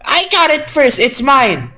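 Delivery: put-on childish squabble. Character squabbling over book.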